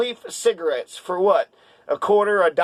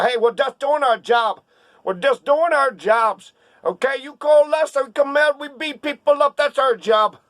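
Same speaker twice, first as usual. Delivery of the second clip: higher-pitched Brooklyn cop voice; Brooklyn accent; 6 semitones higher, 3 dB louder than usual. Acted. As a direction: play a defensive police union guy from Brooklyn. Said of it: "That's my Brooklyn accent".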